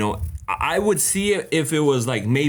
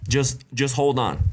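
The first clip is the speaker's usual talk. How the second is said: usual speaking voice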